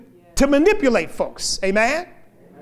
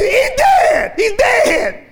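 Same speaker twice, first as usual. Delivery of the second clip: high panicked shout; frantic scream; 11 semitones higher, 5 dB louder, much slower than usual. Retold or imitated. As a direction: scream it in alarm. Woman shouting in retold story.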